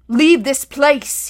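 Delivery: loud, slow guttural menace; commanding growl, each word stretched. A narrator's reading. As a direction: growl it as a drawn-out warning. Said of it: "words that were also a guttural growl".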